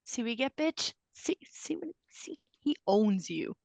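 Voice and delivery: soft, breathless whisper to a friend; hushed whisper. Retold or imitated. Teasing her friend mid-concert in a soft whisper. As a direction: lean in and whisper it, awed.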